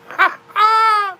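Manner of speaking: a high shrieking cackle of a laugh. Real, not acted. Cackles at own joke.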